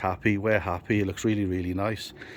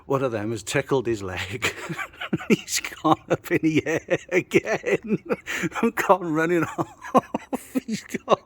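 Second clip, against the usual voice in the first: laughing; 6 semitones higher, slower than usual. Real, not acted. Laughing at Brad's jump.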